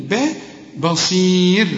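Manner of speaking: بصير with a thin, light b and slow pace. Shown as a demonstration. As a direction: say باء بصير slowly, b kept light. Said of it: "لابد إن احنا نرقق الباء".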